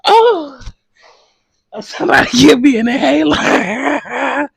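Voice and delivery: high breathless laughing plea; wheezing from laughing too hard. Real, not acted. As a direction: gasp it out through laughter. Laughing fit, can't breathe.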